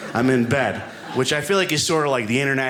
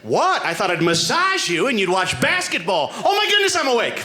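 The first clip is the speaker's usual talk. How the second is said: overjoyed; 9 semitones higher than usual. Retold or imitated. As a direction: squeal with sudden joy. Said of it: "it's never like".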